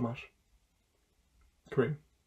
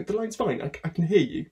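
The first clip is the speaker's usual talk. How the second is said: ordinary talk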